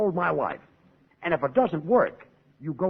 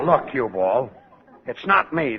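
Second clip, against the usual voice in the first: ordinary talk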